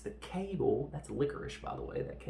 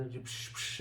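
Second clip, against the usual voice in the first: two quick "psh" smash noises; imitated crash; 4 dB quieter than usual. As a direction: mimic bodies going through glass. Imitating crashes through the glass.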